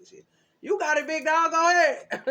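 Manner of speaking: high, loud easygoing call; letting runners pass. Retold or imitated. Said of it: "But I be like".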